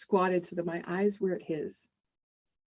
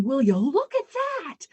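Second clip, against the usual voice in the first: delighted wonder; 6 semitones higher, 4 dB louder than usual. Retold or imitated. Dad awed by automatic faucet.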